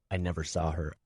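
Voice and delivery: plain reading voice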